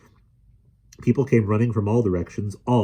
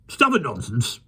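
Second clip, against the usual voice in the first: raucous; 6 semitones higher than usual. A narrator's reading. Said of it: "said a raucous voice".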